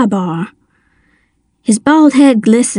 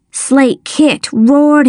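ordinary delivery, nothing marked

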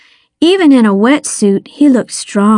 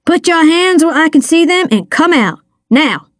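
higher-pitched police command; barked order; 6 semitones higher than usual. A narrator's reading. Detective ordering a hidden figure out, barked. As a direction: order them out, firm and urgent. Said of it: "he barked".

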